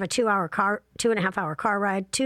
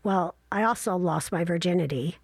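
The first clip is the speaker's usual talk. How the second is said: ordinary talk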